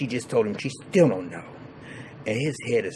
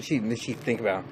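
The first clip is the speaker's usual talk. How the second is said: ordinary delivery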